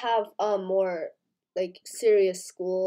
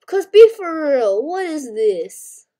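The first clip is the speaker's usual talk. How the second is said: disbelieving; 5 semitones higher, 10 dB louder, slower than usual. Real, not acted. Disbelief at the game items.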